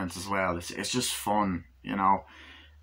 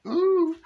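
high ooh; 5 dB louder than usual. Real, not acted. Teasing aside about girlfriend.

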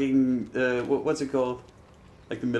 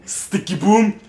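higher, louder sticky boom; imitating sticky boom sound; 7 semitones higher, 8 dB louder, much slower than usual. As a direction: mimic the game sound effect. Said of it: "sound like a sticky boom".

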